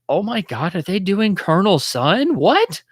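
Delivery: high, rising disbelief, climbing to a squeak on what; a fan suddenly spotting the twist; shocked realization. Retold or imitated. Fan realizes mid-movie they used Colonel Sun, high astonished disbelief.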